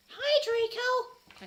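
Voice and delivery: high, loud, slow mopey hello; depressed voice. Acted. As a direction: drag it out gloomily. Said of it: "I said in a depressed voice".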